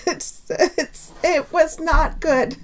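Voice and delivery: tearful laugh that cracks upward; emotional laughter close to tears; half crying. Real, not acted. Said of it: "I could sit here and cry".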